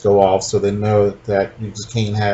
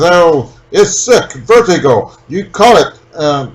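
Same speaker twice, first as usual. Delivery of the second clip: put-on Russian accent; 5 semitones higher, 4 dB louder than usual. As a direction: play the Soviet pilot in Russian accent. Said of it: "I like your Russian accent".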